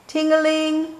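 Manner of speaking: high, ringing ting-a-ling; bell imitation. A narrator's reading. Dad puts the bell on, high chime.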